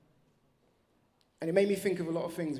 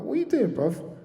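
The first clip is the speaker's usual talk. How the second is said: louder, higher, puzzled question; surprised bruv; 6 semitones higher, 7 dB louder than usual. Retold or imitated. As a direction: ask in disbelief at two a.m. Speaker's own retold reply.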